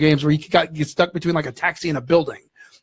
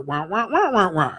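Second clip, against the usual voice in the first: imitated muted-trumpet wah-wahs; trumpet talk; 2 semitones higher, 3 dB quieter than usual. Counter man answers the woman, trumpet talk. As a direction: answer her in wordless trumpet speech. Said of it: "he's talking in trumpet".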